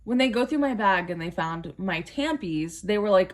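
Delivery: usual speaking voice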